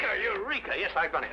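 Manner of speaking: high exultant cry; triumphant eureka. Acted. Holmes celebrating his escape, exultant. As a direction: crow with triumph.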